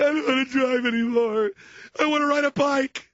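put-on high, loud whining child; mock whiny kid. Scared son whining that he wants to quit driving, high whine. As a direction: whimper it like a scared teen.

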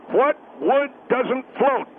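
ordinary delivery

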